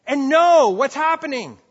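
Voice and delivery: high, loud and slow, with a laugh breaking in; stern command shout. Shown as a demonstration. Parent stopping squabbling kids, a loud stern command. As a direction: bellow it to end a fight. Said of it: "It's like".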